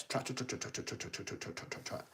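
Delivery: hushed, rhythmic hoofbeat-like patter; quick soft trotting noises. Acted. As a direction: patter "trot, trot, trot" softly. Imitates trotting down the steps.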